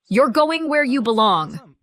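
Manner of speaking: harsh and commanding; snapping. A narrator's reading. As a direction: push the words at him. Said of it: "she snapped".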